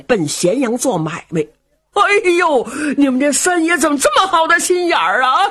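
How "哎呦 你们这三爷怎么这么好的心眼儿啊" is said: high, wailing fake sobs; overplayed tearful gratitude, voice breaking; mock crying. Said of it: "他又哭上了".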